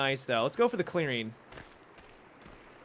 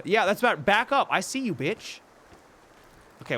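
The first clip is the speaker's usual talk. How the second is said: usual speaking voice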